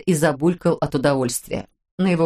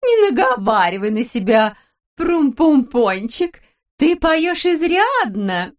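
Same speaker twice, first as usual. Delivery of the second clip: put-on much higher, slow sugary baby talk; cooing wife; 7 semitones higher, slower than usual. A narrator's reading. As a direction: whine sweetly at the husband. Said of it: "сказала Далила Петровна". (высоко, медленно, сюсюкая)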